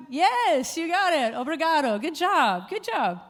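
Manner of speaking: high, loud, excited congratulations; delighted praise. Real, not acted. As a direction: cheer the crowd on for getting it. Cheering a correct quiz answer.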